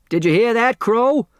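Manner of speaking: put-on high, eager old man calling out; bursting with excitement to his companion; excited. A narrator's reading. Otis Amber to Crow, high and excited. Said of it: "Otis Amber said excitedly".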